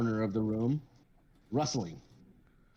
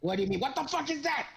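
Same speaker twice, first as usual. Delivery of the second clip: high, shocked outburst; startled old man. Acted. Old man seeing the kobold, high and shocked. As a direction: recoil at the sight. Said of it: "he goes".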